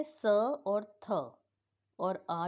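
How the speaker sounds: drawn-out letters; name each letter slowly; careful letter by letter; slow spelling out. Shown as a demonstration. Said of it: "spelling letters slowly".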